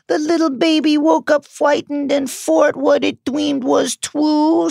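put-on high, taunting baby talk; mock baby voice. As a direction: coo it like mocking a baby. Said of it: "said the woman in a horrible mock baby voice".

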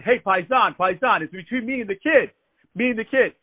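a hint of a New York tough guy insisting; tough guy retort. Angry bully retorts to coworker.

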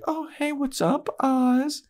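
put-on very high breathy-free girlish squeak, far above his own; Willow voice. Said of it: "She's like".

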